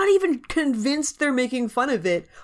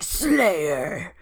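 vampire voice; 3 semitones lower than usual. Retold or imitated. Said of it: "Then he's like".

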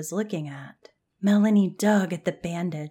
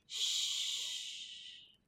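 long, soft shush; soothing shush; 13 dB quieter than usual. A narrator's reading. Jan holding her sick friend's hand, soothing shush. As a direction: hush gently, drawn out. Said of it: "Her voice came out soothing".

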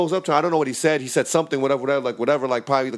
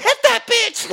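much higher cheering shout; mock crowd yell; 4 dB louder, slower than usual. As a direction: yell like a fired-up bystander.

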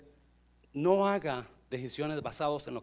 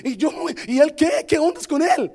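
high, loud, protesting voice; hammed-up indignant grumble; acted complaining; 9 semitones higher, 8 dB louder than usual. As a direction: complain like someone treated unfairly. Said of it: "está quejándose con".

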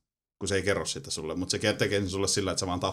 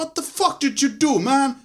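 high, loud shriek of disbelief; mock outraged yell; 7 dB louder, slower than usual. Game characters reacting to the player's shot, high mock yell. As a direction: imitate shocked comrades yelling in a squeal. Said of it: "Ja kaikki oli silleen".

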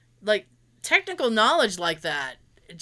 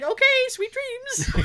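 sweet goodnight; 12 semitones higher, slower than usual. Retold or imitated. Quoting Lucas's goodbye.

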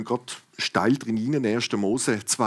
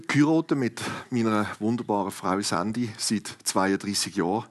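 usual speaking voice

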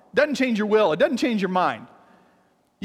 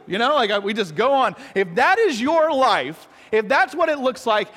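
normal talking voice

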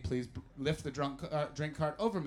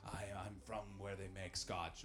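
imitated chesty Scotsman; 7 semitones lower, 11 dB quieter than usual. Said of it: "talking through his chest through a megaphone".

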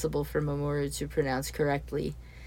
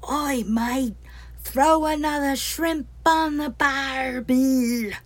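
hammed-up broad Kiwi accent, much higher and slow; mock Bogan Kiwi; 9 semitones higher, 4 dB louder, much slower than usual. Neil shouting in Kane's ear in a mocking Bogan Kiwi accent. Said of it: "Allow me to demonstrate".

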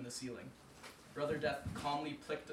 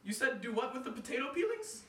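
high, puzzled voice; comic confusion; wife mishearing; 10 semitones higher, 4 dB louder than usual. Acted. Wife mishearing Anansi, high puzzled. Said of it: "his wife asked".

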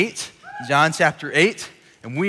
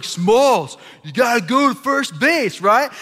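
high, loud mock holler; acted yelling; 8 semitones higher, 4 dB louder, slower than usual. Porter urging frozen batter to run, hollering. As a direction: call out across the ball field.

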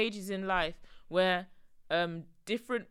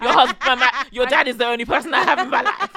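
laughing; 3 semitones higher, 14 dB louder than usual. Retold or imitated. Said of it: "then I'll tell my children".

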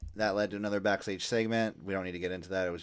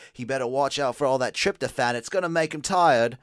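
higher, louder, put-on announcer voice; Nigel impression; 5 semitones higher, 6 dB louder than usual. Retelling Nigel's turkey joke, put-on announcer voice. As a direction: call it out like an excited commentator. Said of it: "and Nigel said".

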